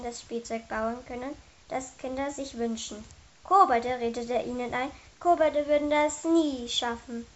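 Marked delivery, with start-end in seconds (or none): none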